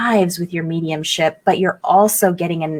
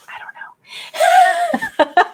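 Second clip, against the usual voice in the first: giggled, whispered and very drawn out; high breathy giggle through the words; laughing whisper; much slower than usual. Real, not acted. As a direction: laugh the words out under your breath, very slowly. Joking about pointing the wrong way.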